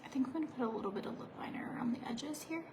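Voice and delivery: soft unvoiced whisper; whisper, like during makeup. Real, not acted. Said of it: "it's hard to talk like this".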